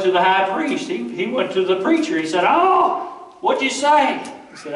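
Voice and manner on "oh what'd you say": put-on startled boy. Voicing young Samuel waking.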